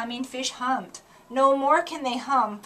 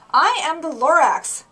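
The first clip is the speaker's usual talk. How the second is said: loud, high, hoarse little grump; coughing Lorax; 6 semitones higher, 11 dB louder than usual. Acted. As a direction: bark out his name through a cough. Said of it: "he coughed and he whiffled".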